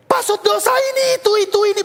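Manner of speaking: put-on high, excited squeaky kid; eager kid voice. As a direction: mimic a curious kid leaning in. Mocking the kid's eager reply. (anak penasaran, suara tinggi dan bersemangat)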